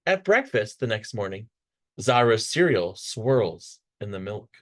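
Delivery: usual speaking voice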